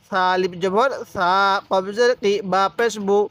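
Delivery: normal talking voice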